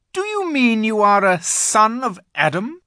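high, loud, imperious question; astonished queen. A narrator's reading. The Queen asks if he is human, astonished. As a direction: demand it in a ringing queenly voice.

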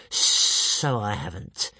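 very slow, hissing letdown; disappointed creature. A narrator's reading. Strangulator realising it lacks weapons, slow disappointed hiss. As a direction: concede it with drawn-out sibilants. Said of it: "very disappointed".

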